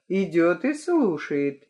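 plain reading voice

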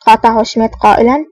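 usual speaking voice

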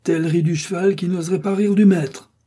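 loud, high angry retort; furious cry. A narrator's reading. The Gascon answers the mockery, furious and loud. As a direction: throw the threat at him. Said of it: "s'écria l'émule de Tréville, furieux".

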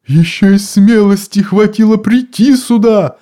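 high, loud outraged cry; indignant shout. A narrator's reading. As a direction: scream it in outrage. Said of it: "закричала голова тёти Гортензии". (возмущённый крик)

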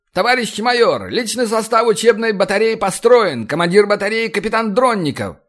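high, strained parade report; formal military report. A narrator's reading. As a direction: report stiffly at attention. Captain reports formation to major.

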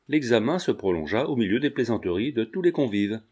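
ordinary delivery

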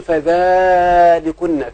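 slow, stretched held vowel; drawn-out emphasis. Shown as a demonstration. Teacher questioning the far pronoun for someone near, drawn-out emphasis. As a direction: hold the long vowel emphatically. Stressing far demonstrative in quote.

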